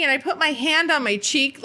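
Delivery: normal talking voice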